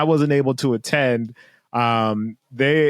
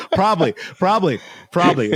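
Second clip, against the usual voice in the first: laughing; 7 semitones higher, faster than usual. Real, not acted.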